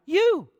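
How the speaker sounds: "You" alone, high, loud, falling pitch; stress on You. Shown as a demonstration. Preacher answers his own question on the emphasized word, "You" stressed. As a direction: punch "You" high, then let it drop. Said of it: "What's he emphasizing?".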